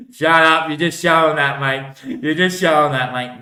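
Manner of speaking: loud, sharp telling-off; angry shout. Acted. Answering back at the other voice, shouting. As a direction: shut him up, loud and cross.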